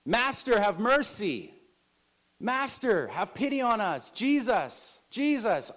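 loud, high-pitched pleading shouts; crying out. Acted. Ten lepers beg Jesus for pity, crying out. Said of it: "ten men were crying out to Jesus".

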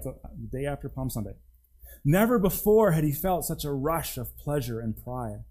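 normal talking voice

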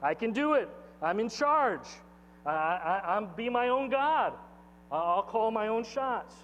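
high, insistent self-assertion; prideful boast. Acted. Pastor voices the spirit of Babylon, prideful boast. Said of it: "that's the spirit of Babylon".

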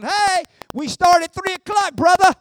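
the distractions calling you away to the game; hammed-up call to watch football; pushy game-day voices. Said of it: "you'll have all them voices".

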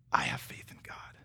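very low, soft near-whisper; hushed declaration. Retold or imitated. Preacher looking back on his daughter's ordeal, hushed. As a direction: drop right down to an intimate, whispered affirmation.

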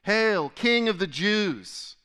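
mock salute. A narrator's reading. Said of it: "they began to salute him".

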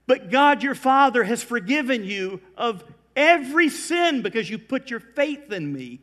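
loud, high, emphatic preaching; forceful exhortation. Acted. Jesus telling Peter of God's forgiveness, forceful exhortation.

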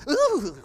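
high, loud shuddering "ooh"; hyena's shudder. Acted. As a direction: shiver out a sudden loud ooh. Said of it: "they literally shook".

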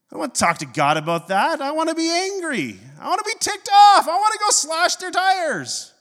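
acted tantrum building to a high, loud yell; comic fury, climbing into a squealing yell; hammed-up angry rant. Acted tantrum about wanting to be angry.

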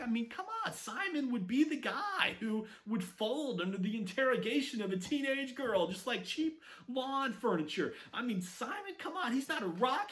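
high, scoffing disbelief; incredulous scoffing. Retold or imitated. Disciple scoffing at Simon's new name, high incredulous voice.

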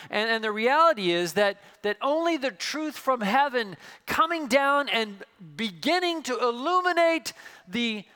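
loud, rising preacher's climax; full-force preaching. Acted. Pastor urging his congregation, loud impassioned preaching. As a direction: drive the message home to the congregation.

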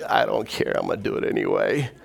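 low, half-whispered defiance; defiant reply. Retold or imitated. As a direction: shrug off the warning under your breath. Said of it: "I was like".

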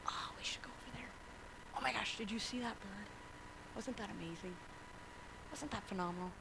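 soft breathy whisper, no voice at all. Acted. Jesus in the boat whispering excitedly into your ear. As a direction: whisper it right into someone's ear. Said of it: "whispering in your ear".

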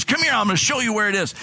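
put-on a touch of New York accent, fast and high; imitating New York accent. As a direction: hurry him along in her New York accent. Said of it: "she has this very thick".

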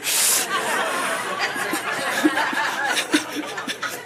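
long unvoiced laughter; real breathy laugh after the quote; airy laugh. Real, not acted.

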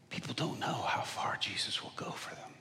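soft whispered quote; breathy whisper. Retold or imitated. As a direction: hush it like a secret. Hitler's whispered confession retold.

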